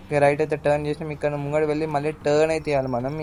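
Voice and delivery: ordinary delivery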